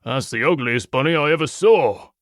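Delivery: gruff wolf henchman butting in; gruff creature jeer. A narrator's reading. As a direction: butt in with a gruff, rough voice. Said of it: "the pale gray one with a gruff voice butted in".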